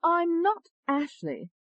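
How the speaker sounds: put-on very high, slow, emphatic English accent. A narrator's reading. Alter ego correcting the doctor, high English accent. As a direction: deny it in a high-pitched English voice. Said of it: "said in an English accent".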